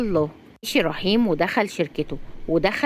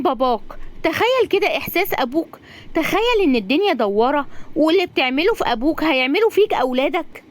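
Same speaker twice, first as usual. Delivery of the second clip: high, scolding female-character voice; pitched-up, impassioned reproach; indignant young woman; 6 semitones higher than usual. Acted. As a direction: lecture him with rising indignation. Razan scolds Magdy in story.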